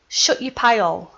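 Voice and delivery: thick Broad Yorkshire, dropping the h in hole. Shown as a demonstration. Showing viewers her native Broad Yorkshire saying. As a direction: say it like a born Yorkshire lass. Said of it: "I'm gonna say it in my native tongue, which is Broad Yorkshire".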